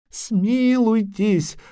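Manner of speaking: high, long-drawn plea for mercy; shrieking doppler begging for his life; heart-rending wail. A narrator's reading. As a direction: wail desperately, stretching the word. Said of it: "душераздирающе завопил Доплер".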